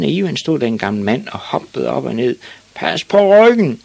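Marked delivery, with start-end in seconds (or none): 2.7-3.8 loud, high, drawn-out yell from the sidelines; screamed. Acted. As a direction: scream advice at the players. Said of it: "skreg den gamle mand".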